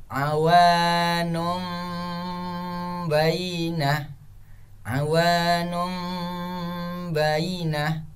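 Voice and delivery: slow chanted "awanum bainah", long hummed m; level chant with humming "awanum"; iqlab recitation. Shown as a demonstration. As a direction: hold the m of "awanum" before "bainah". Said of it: "Contoh yang kedua".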